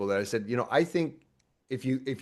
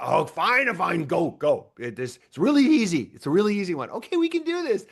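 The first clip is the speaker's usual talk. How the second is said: higher, louder giving-in voice; exasperated God; 6 semitones higher, 5 dB louder than usual. Retold or imitated. God giving in to whining humans, higher and louder. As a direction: relent like a tired parent. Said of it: "And he's like".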